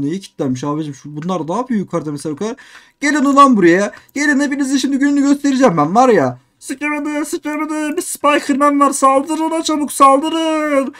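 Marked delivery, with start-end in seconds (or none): 3.0-6.4 loud taunting call at the horde; loud, higher gamer taunt; taunting yell. Acted. 6.6-10.9 lightly put-on higher voice giving orders; lightly voiced game villain, higher; character voice. Character voice commanding attack.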